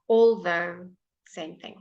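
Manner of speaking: usual speaking voice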